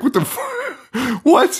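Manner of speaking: high-pitched, drawn-out disbelief; incredulous reaction. Acted. Podcaster reacting to the cops letting the victim go, incredulous. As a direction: squeak out baffled mock disbelief. Reacting to absurd police decision.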